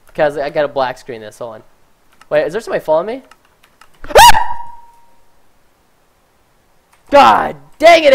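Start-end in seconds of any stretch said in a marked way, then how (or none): 4.0-4.8 sharp breathless gasp of fright; startled gasp. Real, not acted. Startled by player behind him. 7.1-8.1 loud, slow yell of fright; startled yell. Real, not acted. Just got scared by stalker.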